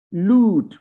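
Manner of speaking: लूट with a stretched oo; long oo. Shown as a demonstration. Teacher models the long oo in लूट. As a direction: draw out the oo in लूट. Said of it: "ये ऊ लंबी sound है".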